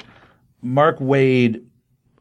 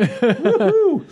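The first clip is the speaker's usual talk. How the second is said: a laugh breaking into a much higher woohoo; nervous laugh-cheer. Real, not acted. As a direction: laugh it off with a sudden high whoop. Laughing off his emotion.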